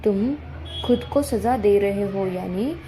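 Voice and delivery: ordinary talk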